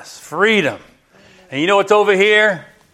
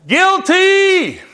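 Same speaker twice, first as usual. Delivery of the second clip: crying out guilty; 11 semitones higher than usual. Acted. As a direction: call it out like a verdict. Blood cries out, acted verdict.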